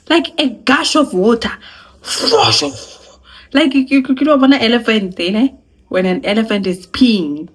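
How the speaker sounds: excited comic storytelling. Real, not acted. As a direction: hype up the comparison.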